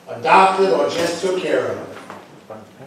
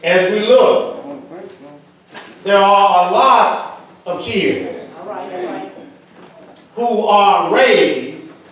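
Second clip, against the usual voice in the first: raised preacher's voice with weighty pauses; forceful preaching; 4 semitones higher than usual. Real, not acted. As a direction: drive each phrase out to the congregation, higher pitched. Preaches to congregation.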